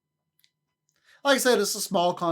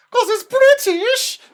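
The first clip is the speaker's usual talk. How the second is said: high, loud gushing squeal; excited squeal; 14 dB louder, slower than usual. Real, not acted. Streamer squealing over a British accent. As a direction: squeal it out with delight.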